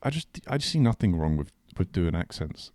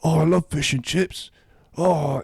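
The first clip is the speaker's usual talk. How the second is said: put-on higher, drawn-out British accent; mock British accent; 6 semitones higher, 4 dB louder, slower than usual. Quoting an Indian man at a table, mock British accent. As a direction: exclaim it slowly in an exaggerated British accent. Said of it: "one of them's doing a British accent".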